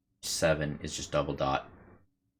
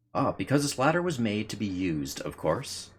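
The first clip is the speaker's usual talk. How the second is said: firefly voice; 6 semitones higher than usual. Acted. Voicing the firefly's answer.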